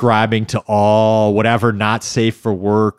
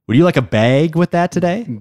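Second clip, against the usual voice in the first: bright Minnesota shopkeeper voice, higher, "bag" said with a flat Minnesota a; Minnesota accent; 7 semitones higher than usual. Shown as a demonstration. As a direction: play a Minnesota shoe salesman offering a "bag". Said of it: "Can you say it again?".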